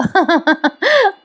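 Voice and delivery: high, bubbling giggle; amused laugh. Real, not acted. Narrator amused by helpless brothers, high giggle.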